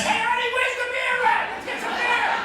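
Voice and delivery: rowdy shopper. Retold or imitated. Maskless guy barging through.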